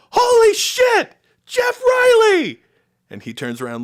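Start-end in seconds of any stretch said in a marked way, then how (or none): 0.1-2.6 very high, loud, slow shout of recognition; excited shout. Retold or imitated. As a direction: yell out the name in delighted surprise. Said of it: "was just go".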